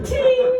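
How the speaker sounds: very high sung ting; ping like a bell; imitated ding. Comic imitating a chime sound.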